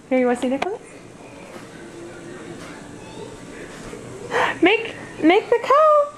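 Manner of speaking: put-on bright coaxing toddler talk; reading to a toddler. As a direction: coax a little child gently.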